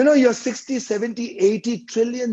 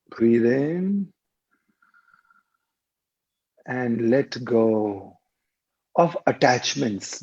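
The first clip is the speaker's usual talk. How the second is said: lower-pitched meditation voice with long pauses; guided meditation; 4 semitones lower than usual. Real, not acted. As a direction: guide listeners through each breath, drawing out the cues. Leads a guided breathing meditation.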